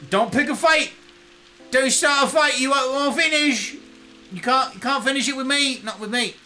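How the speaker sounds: high, scrappy put-on Cockney; mock Cockney. Unreasonable Brit warns viewers off a fight, mock Cockney. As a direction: warn them off a fight in a blended British accent. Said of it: "I'm gonna slip in and out of a Cockney".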